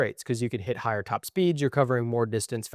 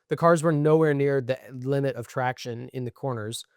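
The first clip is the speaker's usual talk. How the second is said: ordinary talk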